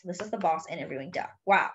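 usual speaking voice

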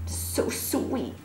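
hushed unvoiced whisper; soft whisper. Real, not acted. Over her sleeping dog, a hushed whisper. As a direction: breathe it so the dog stays asleep.

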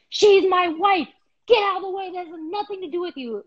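high-pitched indignant yelling; imitated angry husband. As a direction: re-enact a man warning off a stranger. Acted quarrel, husband yells back.

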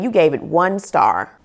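usual speaking voice

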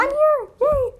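very high, loud cheer; high-pitched celebratory shout; excited cheer. Real, not acted. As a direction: announce yourself with a loud squeal of joy. Character pops back into game.

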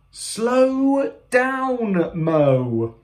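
high, drawn-out warning; storybook cry of alarm; alarmed call. Acted. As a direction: call out high, falling on the name.

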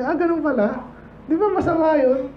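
naive outsider. Retold or imitated. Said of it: "bigyan mo lang ng ganyan".

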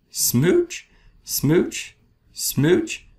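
each "smooch" sung up high with a lift; kissy rising smooches; playful kisses swooping up. Acted. Fish's happy new refrain.

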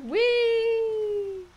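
high happy squeal; gleeful whee. Real, not acted. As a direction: squeal with joy. Gleeful high cry while driving.

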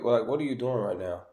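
ordinary delivery

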